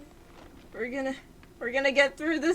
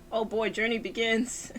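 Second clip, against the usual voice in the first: normal talking voice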